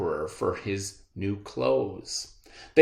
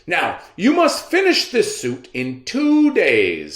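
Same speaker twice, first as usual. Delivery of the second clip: higher, louder royal command; commanding emperor; 6 semitones higher, 6 dB louder than usual. Acted. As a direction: give the order like a vain emperor. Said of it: "said the emperor".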